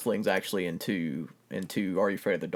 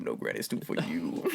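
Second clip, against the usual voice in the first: low whispery cartoon voice; 11 semitones lower, 7 dB quieter than usual. Quoting cartoon character.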